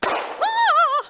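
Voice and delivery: cry. Acted. Character suddenly attacked.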